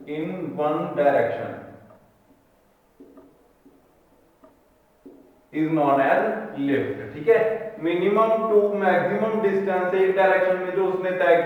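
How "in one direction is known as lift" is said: very slow dictation with long pauses. Real, not acted. Teacher giving the definition of lift to write, slow dictation. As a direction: dictate phrase by phrase, leaving time to write.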